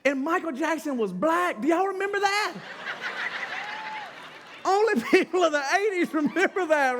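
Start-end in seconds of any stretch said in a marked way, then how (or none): 2.6-4.6 a long high laugh, softer. Real, not acted. Laughing at own joke.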